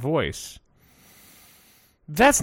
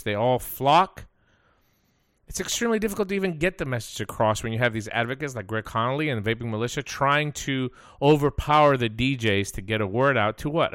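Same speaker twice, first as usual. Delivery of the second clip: ordinary talk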